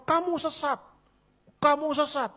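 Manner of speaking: "Kamu sesat" flung out high and sharp; high mock accusation. Shown as a demonstration. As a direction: accuse them, pitched high. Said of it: "Anda katakan". (tuduhan "Kamu sesat" dengan nada tinggi)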